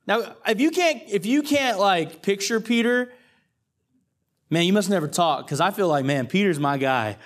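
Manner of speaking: ordinary delivery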